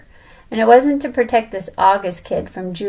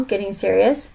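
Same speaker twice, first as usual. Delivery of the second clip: ordinary delivery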